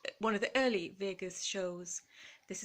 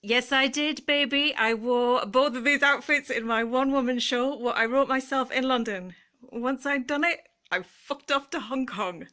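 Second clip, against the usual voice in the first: cheeky bragging; 4 semitones higher, 8 dB louder than usual. Real, not acted. Cheeky showgirl brag.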